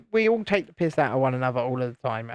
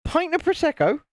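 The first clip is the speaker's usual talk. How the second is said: much higher, incredulous squeak; mock disbelief; 12 semitones higher than usual. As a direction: act stunned at the news. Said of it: "We're like".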